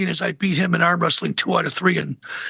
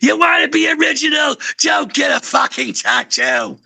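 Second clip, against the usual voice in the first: [name] shouting; 9 semitones higher than usual. Retold or imitated. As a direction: yell it like [name] giving advice. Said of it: "He says".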